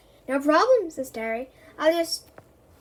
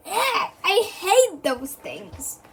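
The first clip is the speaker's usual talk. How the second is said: disgusted; 6 semitones higher, 5 dB louder, slower than usual. Acted. Disgusted at frogpotamus.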